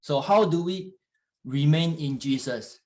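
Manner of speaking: normal talking voice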